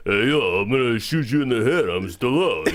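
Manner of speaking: hammy low impression of an action star; bad Vegas-strip performer doing a tough guy, low; Stallone. Filmgoer clowning to a buddy leaving the theater, hammy action-star impression. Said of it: "world's worst, most stereotypical ... performer impression".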